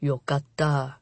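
soft, low half-whisper; relieved whisper. A narrator's reading. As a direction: breathe it out in relief. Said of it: "he whispered".

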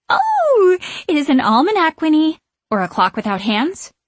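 very high, loud, delighted realisation; high-pitched, airheaded witch voice; flighty witch discovering. A narrator's reading. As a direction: exclaim with naive wonder.

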